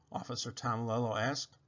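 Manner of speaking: ordinary delivery, nothing marked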